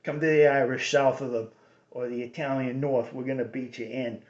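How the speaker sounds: tough South End Irish Boston accent; a light threat in Irish Boston tone. Shown as a demonstration. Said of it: "Irish tone".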